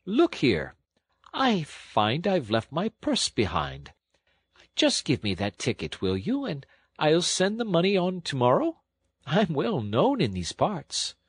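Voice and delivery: lofty mix of squire and college don; squire's grand manner. A narrator's reading. Toad bluffing the clerk for a ticket, grand squire manner. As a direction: put on a fine old gentlemanly manner. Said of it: "with a return to his fine old manner".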